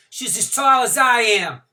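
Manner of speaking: put-on loud, high, breezy young mother; gushing, careless Courtney.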